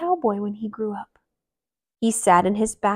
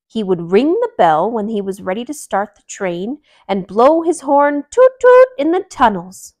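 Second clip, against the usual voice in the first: great uncle; 2 semitones higher, 7 dB louder than usual. Acted. Great uncle bunny voiced, toot toot.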